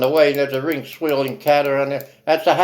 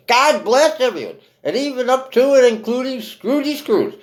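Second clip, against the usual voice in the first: put-on high, loud little-boy voice for Tiny Tim; a hint of a small child, raised high and loud; 9 semitones higher, 4 dB louder than usual. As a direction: pipe it up high like a small boy blessing everyone. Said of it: "That's what Tiny Tim saying".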